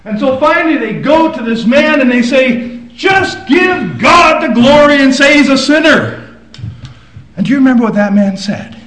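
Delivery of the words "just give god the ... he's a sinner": loud, slow, higher pressing demand; demanding shout. Acted. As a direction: bark it like an interrogator. Leaders pressuring the healed man.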